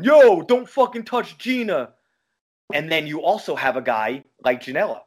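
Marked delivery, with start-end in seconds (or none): 0.0-1.9 high, loud hammed-up Jersey tough guy yell; mock Italian-American yell. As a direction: ham up a corny Italian accent. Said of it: "Enzo wasn't yelling".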